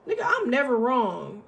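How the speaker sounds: ordinary talk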